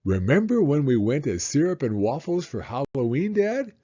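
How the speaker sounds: a hint of a small boy talking to his dad; lightly voiced little boy. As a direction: read it as the excited little boy. Child voice reading son's lines.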